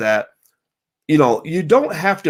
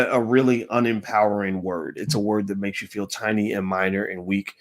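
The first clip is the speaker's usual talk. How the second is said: usual speaking voice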